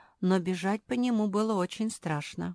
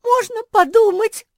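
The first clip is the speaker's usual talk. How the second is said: high squeak, loud and sharp; squeaky toy; 14 dB louder than usual. A narrator's reading. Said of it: "пропищала Куки".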